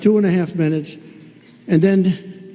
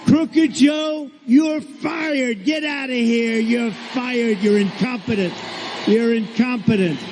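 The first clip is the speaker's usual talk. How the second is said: high, slow mock outburst; mock firing shout; 4 semitones higher, slower than usual. Telling the crowd what America should yell at Joe, mock outrage, high and slow. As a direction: fire him like a boss, pitched high and slow. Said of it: "we should be saying".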